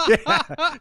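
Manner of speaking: loud high laugh into yeah; real laughing yeah. Real, not acted. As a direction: burst out laughing. Laughing at the kangaroo joke.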